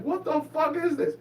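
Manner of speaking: high-pitched, incredulous protest; squeaky-high retold disbelief; mock outrage. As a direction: act out a bewildered kid's indignation. Child outraged at laughing family.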